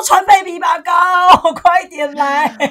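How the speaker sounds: loud, hammed-up shout, dragged out slowly at the end; mock shout. Co-host jokingly shouts a plea for help, loud and slow. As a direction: yell it out as a joke.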